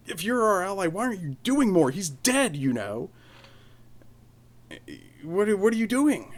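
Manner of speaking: high, loud furious outburst; furious yell. Retold or imitated. Mark confronting the Emperor over a dead ally, high furious shout. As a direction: yell the accusation with the pitch way up. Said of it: "he's furious".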